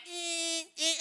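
high, soft honk noise; high held beep, like a horn; imitated car horn. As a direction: mimic someone leaning on the horn. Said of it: "he starts to honk on the horn".

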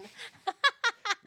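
high, loud burst of laughter; big delighted cackle. Real, not acted. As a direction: crack up at your own joke. Cracking up at her own joke.

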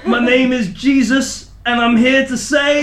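high, loud proclaiming mock prophet; channeling Jesus. Fake medium proclaiming as Jesus to friends. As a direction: announce yourself like a possessed preacher. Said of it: "I'm getting something".

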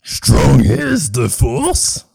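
slow, croaky Yoda line. Acted. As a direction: drag it out slowly like Yoda. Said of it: "talking to Doctor Yoda".

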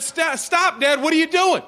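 put-on high, loud alarmed child; imitating a daughter yelling in alarm; mock yell. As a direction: cry out to stop dad. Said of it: "she yells at me".

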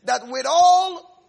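loud stretched-out preaching; fervent loud scripture. Acted. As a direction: proclaim it slowly at full force. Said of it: "He says".

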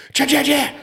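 very high, loud mimicked chatter; funny southern holler. Storyteller mimicking his excited partner spotting the second flock, high southern holler. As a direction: imitate a buddy shrieking out a duck sighting. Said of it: "Starts doing that southern".

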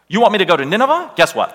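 high, fast, cheeky challenge; defiant retort. Acted. Jonah answering God's call to Nineveh, defiant retort. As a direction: throw the order back as an incredulous question.

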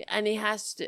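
normal talking voice

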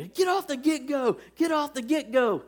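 high mock Aussie coach call; Aussie accent. Assistant coach urging player at the snap, mock Aussie accent. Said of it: "he's got an Aussie accent right now".

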